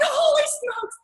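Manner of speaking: high astonished exclamation; amazed. Retold or imitated. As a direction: gasp it out in disbelief. Their team named number eight.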